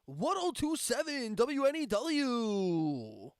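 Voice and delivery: loud, high, drawn-out station ID; slow booming call letters, sliding down at the end; radio voice. Shown as a demonstration. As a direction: belt it like a hammed-up rock DJ. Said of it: "do my radio voice for you".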